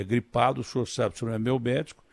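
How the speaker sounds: normal talking voice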